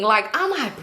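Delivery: ordinary talk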